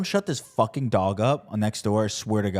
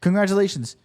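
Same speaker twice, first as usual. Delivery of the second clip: sarcastic, louder; 9 semitones higher, 4 dB louder than usual. Acted.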